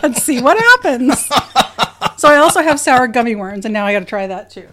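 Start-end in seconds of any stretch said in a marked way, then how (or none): 0.0-1.2 laughing. Real, not acted. Joking about inserting the tablet.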